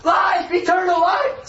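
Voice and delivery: running off, crying out for eternal life. Acted. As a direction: call it out high and strong, slowing at the end. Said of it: "ran on crying".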